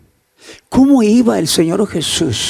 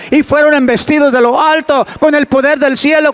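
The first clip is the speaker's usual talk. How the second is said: raised, fast, fervent preaching; preaching at full force; 7 semitones higher, 3 dB louder than usual. Acted. Preacher proclaiming Pentecost to the church, fervent and fast. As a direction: drive the climax home, higher and quicker.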